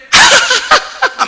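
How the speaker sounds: high mock laughter; pretend baby laugh. Preacher mimics a newborn at cord-cutting, mock laughing. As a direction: burst out in a put-on giggle. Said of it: "막 이래요?".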